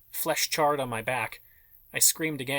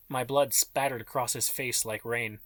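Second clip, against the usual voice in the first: ordinary delivery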